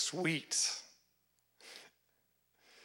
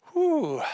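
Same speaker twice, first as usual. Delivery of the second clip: whew; 11 semitones higher, 4 dB louder than usual. Acted. Preacher reacts to Haman's fall.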